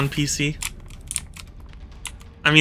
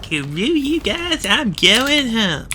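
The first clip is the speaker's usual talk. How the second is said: put-on high, slow whiny kid voice; a high, sulky storm-off line; Cartman impression; 7 semitones higher, slower than usual. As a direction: whine it like a sulky cartoon kid. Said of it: "as Homer Simpson used to say".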